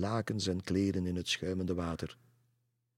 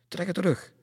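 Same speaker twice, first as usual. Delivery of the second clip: high, loud order; urgent command; 11 semitones higher, 7 dB louder than usual. A narrator's reading. Pastor orders the ladies, urgent command. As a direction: order it sharply.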